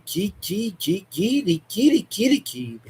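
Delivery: fervent cry breaking into repeated syllables; ecstatic tongues. Real, not acted. Praying over the demonised daughter, breaking into ecstatic tongues. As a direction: let the prayer spill into pulsing tongues.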